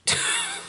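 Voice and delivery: high giggle at own voice; embarrassed laugh. Real, not acted. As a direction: break into a sheepish laugh. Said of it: "Don't ask what that voice was supposed to be".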